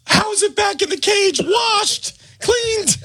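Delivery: high shouted disbelief; horrified shout. Retold or imitated. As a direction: shriek it in horror. Miss Lamont horrified at the bunny.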